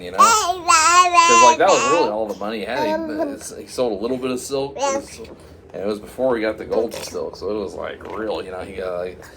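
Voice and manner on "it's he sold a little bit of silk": plain reading voice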